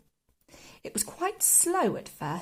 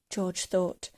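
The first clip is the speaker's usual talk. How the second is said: usual speaking voice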